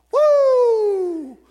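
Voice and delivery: loud, high hallway whoop; captain's woo. Retold or imitated. Pastor imitating the cheerful captain arriving each shift, loud high whoop. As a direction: whoop down the hall like the happy captain. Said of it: "walked in there like".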